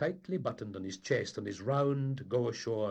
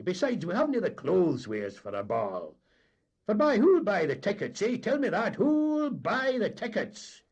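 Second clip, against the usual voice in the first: boastful captain; 9 semitones higher, 10 dB louder than usual. A narrator's reading. Captain bragging to the mate, loud and high. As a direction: puff up with clan pride.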